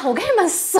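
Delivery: ordinary talk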